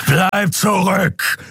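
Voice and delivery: slow, high-pitched fierce order; commanding. A narrator's reading. Enraged attacker orders the onlookers back, commanding. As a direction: order them back, stretching the words. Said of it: "befahl Keru".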